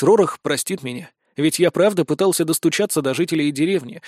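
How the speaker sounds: usual speaking voice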